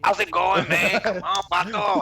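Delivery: put-on swaggering Spanish accent. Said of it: "how to do a Spanish accent unless it's".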